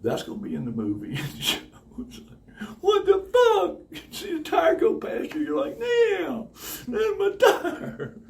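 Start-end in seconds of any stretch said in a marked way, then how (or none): 2.8-8.2 high, loud disbelief, breaking into laughter; high-pitched comic dismay; astonished outburst. Acted. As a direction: cry out in shock, half laughing. Mimes watching own tire roll past.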